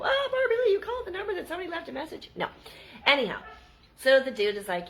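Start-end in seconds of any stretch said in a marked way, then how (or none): 0.0-2.3 high, fast teasing voice with a laugh; mocking viewer. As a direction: scold herself in a high, laughing, fast mock voice. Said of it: "just for you guys go".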